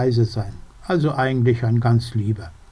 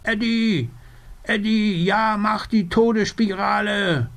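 high, eager cheering from a little raven; 8 semitones higher than usual. Acted. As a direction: egg him on with a squeaky raven voice. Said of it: "feuert ihn an".

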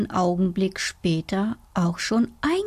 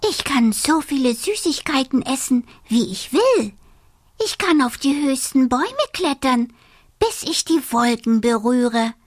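excited high dormouse voice; 4 semitones higher, faster than usual. Acted.

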